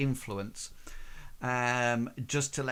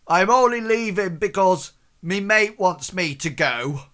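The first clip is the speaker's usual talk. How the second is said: higher, louder defensive bluster; face-saving excuse; 7 semitones higher, 9 dB louder than usual. Acted. Thug excusing his retreat to his mate, loud and defensive. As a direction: save face as you leave. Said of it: "and he says".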